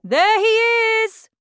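high, loud cry from a crowd; excited shout. A narrator's reading. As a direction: call out the first sighting. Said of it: "somebody shouted".

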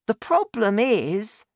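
put-on high child's voice, left hanging; young son. A narrator's reading. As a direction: begin explaining to Dad. Boy starts explaining to father.

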